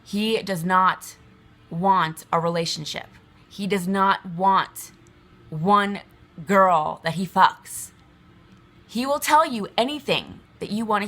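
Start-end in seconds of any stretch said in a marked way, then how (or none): 0.0-3.1 slow, deliberate stress on not; slow emphasis. Real, not acted. Warning women, slow emphasis. 3.5-7.9 slow, deliberate stress on not; slow emphasis. Real, not acted. Warning women, slow emphasis.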